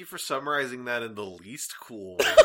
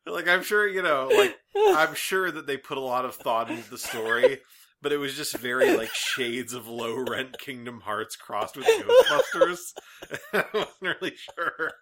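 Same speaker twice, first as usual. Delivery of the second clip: louder, laughter bubbling through words; barely holding in laughter; laughing while talking; 7 dB louder than usual. Real, not acted. Still laughing after fit.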